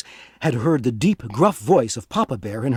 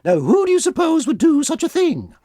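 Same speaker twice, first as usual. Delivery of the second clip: much higher, sweet mother bear; high motherly bear, gently puzzled; Mama Bear answering Papa Bear about the soup; 12 semitones higher than usual. Acted. Mama Bear voiced by narrator.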